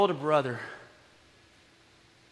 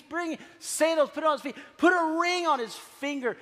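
father calling out; 9 semitones higher than usual. Retold or imitated.

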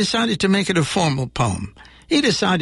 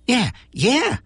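eager, higher yeah-yeah; excited; 7 semitones higher than usual. Acted. Monster eagerly agreeing with Jack, higher and excited. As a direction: bounce on it, keen. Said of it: "said the monster excitedly".

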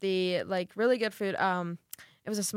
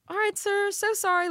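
imitating a tearful, very high-pitched apology; small high voice, placating while near tears; verge of tears; 12 semitones higher, 3 dB louder than usual. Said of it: "on the verge of tears".

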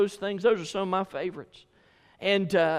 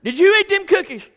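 very high, very loud repeated demand, stress on you; angrier question; 12 semitones higher, 12 dB louder than usual. Retold or imitated. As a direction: blow up at the lying kid.

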